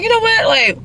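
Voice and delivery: high, giddy and upbeat; bubbly let's-have-fun voice; excited. Retold or imitated. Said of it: "But I was like".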